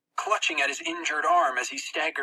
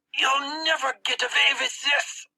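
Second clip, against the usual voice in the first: put-on higher defiant boy voice; 11 semitones higher, 3 dB louder than usual. Max defying the narrator who pins his arm. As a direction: spit defiance back, lightly voiced.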